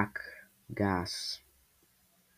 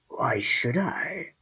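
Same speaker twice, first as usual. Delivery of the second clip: slow, higher creature voice, cocky; confident; 4 semitones higher, much slower than usual. A narrator's reading. Creature answers the squall from the shadows, confidently. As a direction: draw it out with confidence. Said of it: "the creature said confidently".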